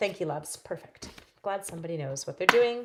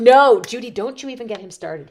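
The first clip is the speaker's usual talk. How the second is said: playful scold; 4 semitones higher, 12 dB louder than usual. Real, not acted. Scolding viewer Judy.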